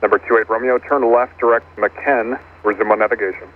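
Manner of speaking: controller over the radio; radio-filtered ATC instruction; air traffic control radio. Real, not acted.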